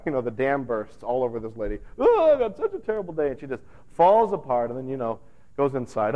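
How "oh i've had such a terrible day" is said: higher, louder hammed-up weepy lament; mock sobbing. Becky pours out her terrible day, mock sobbing. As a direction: whine it out like a comic breakdown. Becky falls apart crying.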